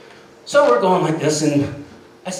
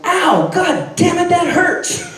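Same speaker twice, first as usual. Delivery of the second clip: high, loud, slow yelp of pain; pained outburst; 12 semitones higher, 4 dB louder, slower than usual. Retold or imitated. Dina yelling at the rider who grabbed her, pained shout. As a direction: shout it high, stretching each word. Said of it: "Dina goes".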